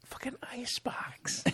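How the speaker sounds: unvoiced disbelieving whisper; whispered aside. Real, not acted. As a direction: whisper it under your breath.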